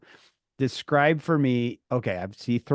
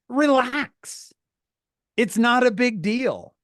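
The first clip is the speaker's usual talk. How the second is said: put-on dismissive teen; 9 semitones higher, slower than usual. Teen brushing off parents.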